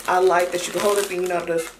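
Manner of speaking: talking around hookah mouthpiece; muffled lips on pipe; mouthpiece between lips. Real, not acted. Seen 0: Metal hookah mouthpiece held at and between lips, smoke after.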